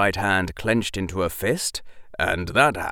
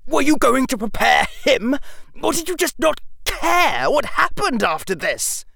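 higher, louder, shaking with rage; trembling with rage; 5 dB louder than usual. Acted. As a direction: accuse him, barely holding back. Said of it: "His voice trembled with rage".

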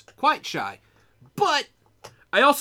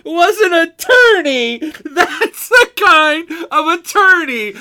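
high, slow screamed comic rant; Sam Kinison; 9 semitones higher, 11 dB louder, slower than usual. Acted. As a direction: belt it out in drawn-out mock outrage. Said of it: "I started getting half Sam Kinison".

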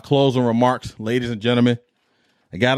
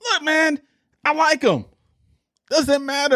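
pitched up high, fed-up pushback; exasperated reply. Real, not acted. To debate show hosts, a high exasperated brush-off.

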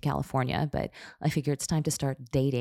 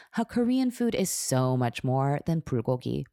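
usual speaking voice

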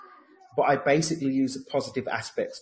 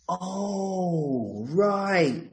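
drawn-out, higher-pitched "oh, right"; dawning realisation; 8 semitones higher than usual. Retold or imitated. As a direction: relive the penny dropping, pitched up. Acts out her moment of realisation.

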